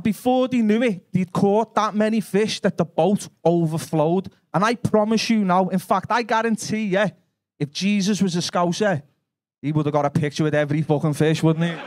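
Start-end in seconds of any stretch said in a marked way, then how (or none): none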